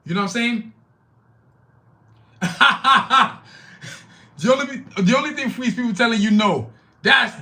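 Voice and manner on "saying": loud burst of laughter; laughing. Real, not acted. Laughs after his own remark.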